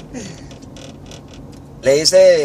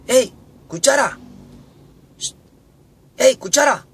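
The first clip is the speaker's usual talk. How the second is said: high-pitched hailing across the table; calling out; 10 semitones higher than usual. Acted. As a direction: call the spoon over in a high voice. Tenedores llaman a la cuchara. (llamando con voz aguda)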